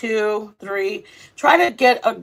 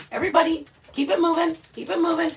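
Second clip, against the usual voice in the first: herding the dogs; 7 semitones higher, 4 dB quieter, faster than usual. Real, not acted.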